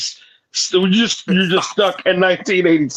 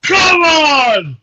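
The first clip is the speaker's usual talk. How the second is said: loud high "come on"; real hyped yell; excited shout; 9 semitones higher, 5 dB louder than usual. Real, not acted. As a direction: yell it to hype everyone.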